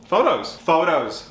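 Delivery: high, loud burst of excitement; excited showing off. Real, not acted. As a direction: announce it like a proud reveal. Showing off parade photos.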